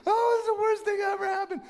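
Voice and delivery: high, fast hammed-up crying wail; mock sobbing despair. Preacher mimicking the losing team, hammed-up tearful wail. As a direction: wail like the player who missed. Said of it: "they're like, start crying".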